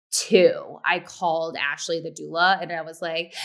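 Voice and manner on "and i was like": normal talking voice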